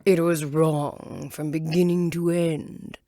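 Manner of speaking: slow, loud, stern caterpillar voice. Acted. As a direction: lay down the verdict slowly like a grumpy caterpillar.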